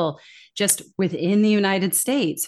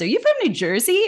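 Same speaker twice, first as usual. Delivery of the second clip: quoted question, pitched high and a little louder; mock surprised question; 10 semitones higher, 4 dB louder, faster than usual. Someone asks the attorney where they're from, in mock surprise. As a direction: imitate someone asking, high and curious.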